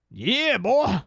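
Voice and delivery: put-on high, loud "Yeah, boy". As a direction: hoot like a rural fan. Mocking rural fans.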